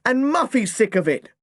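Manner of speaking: put-on boy shouting on behalf of sister; yelling. A narrator's reading. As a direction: spit out the frustration. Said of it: "I yelled again".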